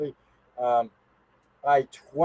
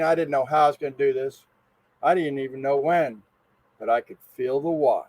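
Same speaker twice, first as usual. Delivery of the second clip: ordinary talk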